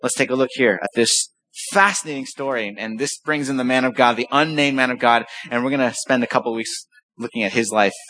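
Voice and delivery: ordinary talk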